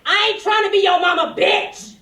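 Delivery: loud, high clap-back shout; retold yell. Classmate yelling back at [name] in class, loud and high. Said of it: "she clapped back at me".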